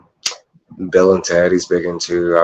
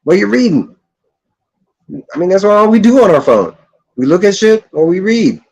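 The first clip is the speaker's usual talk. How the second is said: higher, louder ranting pitch; animated rant; 11 semitones higher, 3 dB louder than usual. Real, not acted. Vlogger ranting to viewers about phones, pitched up. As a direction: push the point hard.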